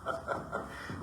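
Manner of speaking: laughing through the words. Real, not acted. Laughing at host's joke about a kepi on the grill. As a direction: crack up at the joke.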